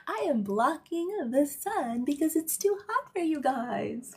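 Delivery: high, self-satisfied mock voice; mocking smug pilot. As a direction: play a pilot proud of his good deed. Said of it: "And they're like".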